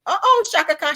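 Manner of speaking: loud, high, thrilled shout-out; excited. Real, not acted. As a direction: call the name out with delight. Greeting a viewer with a fan's excitement.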